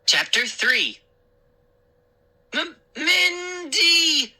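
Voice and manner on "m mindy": slow, stammered moan; weak moan. A narrator's reading. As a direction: moan her name as if hurt. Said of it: "I moaned".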